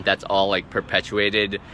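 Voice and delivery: normal talking voice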